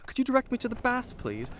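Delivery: high, fast, overly polite asking; prim mock courtesy toward a monster; mock polite question. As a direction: sarcastically play the lost traveller. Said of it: "I'll just ask the first sand creature".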